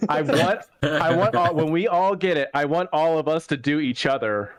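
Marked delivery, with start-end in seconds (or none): none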